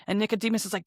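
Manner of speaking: usual speaking voice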